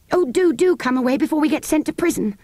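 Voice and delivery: high, urgent anxious plea; anxious girl. A narrator's reading. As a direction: beg them to hurry away. Anthea begs to leave.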